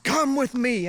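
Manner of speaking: high-pitched barked order; mock-yell it like an angry guard; imitated angry yell. Angry guard orders traveler along, imitated yell. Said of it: "he's, like, yelling at me".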